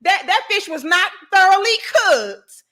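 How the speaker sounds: indignant, emphatic mock rant; customer going off. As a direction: imitate an outraged complainer laying out the charge. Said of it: "This bitch went off".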